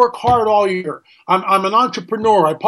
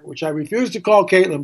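usual speaking voice

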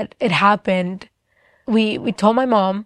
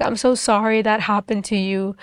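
usual speaking voice

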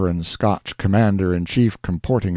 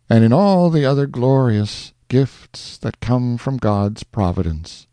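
lofty, higher backwoods hero reciting; a woodsman's high-flown poetic answer; majestic woodsman; 5 semitones higher than usual. Acted. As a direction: declaim it like a grand frontiersman. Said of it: "this is his majestic answer".

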